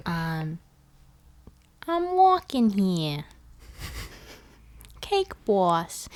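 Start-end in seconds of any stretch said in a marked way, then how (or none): 1.9-6.1 New York accent, slow and drawn on walking; New York accent, leaning on the aw sound. Shown as a demonstration. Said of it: "I have a good New York accent".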